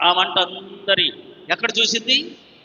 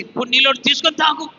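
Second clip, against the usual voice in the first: fast, louder, higher snap back; tossing it off as an annoyed spouse; mock irritated retort; 7 semitones higher, 4 dB louder, faster than usual. Tired spouse answering a request for water, mock irritated snap.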